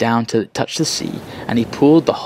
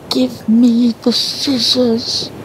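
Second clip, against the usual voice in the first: tiny, much higher, very slow crab plea; very small voice; 11 semitones higher, much slower than usual. Acted. Shrunken crab asks the girl for scissors, tiny high voice. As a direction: shrink to a tiny high voice and stretch it out. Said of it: "crying in a very small voice".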